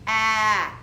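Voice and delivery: ah, punched out loud as short A; short A sound. Shown as a demonstration. Teacher isolating the vowel in happen, short A. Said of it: "It's making short A".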